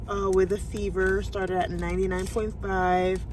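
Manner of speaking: ordinary talk, nothing marked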